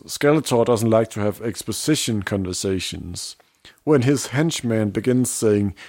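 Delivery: normal talking voice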